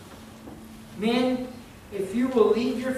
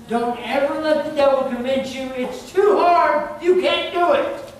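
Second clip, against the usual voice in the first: preaching build; 5 semitones higher, 5 dB louder than usual. Real, not acted. Preacher exhorting congregation.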